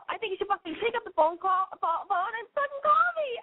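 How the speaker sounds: high, shouted plea to call; pushy shout. Real, not acted. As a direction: yell at them to pick up the phone. Urging listeners to call in.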